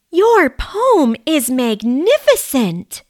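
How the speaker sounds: gushing with admiration; exclaiming. Acted. Mouse praising Ed's poem, excited exclamation.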